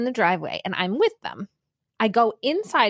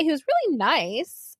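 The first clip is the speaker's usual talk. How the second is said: ordinary delivery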